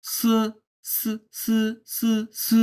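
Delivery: "স" as a strongly aspirated ts; lots of air. Shown as a demonstration. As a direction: push a big puff through each "স". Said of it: "অনেক পরিমাণ বাতাস বের হচ্ছে". (অনেক বাতাস দিয়ে "স")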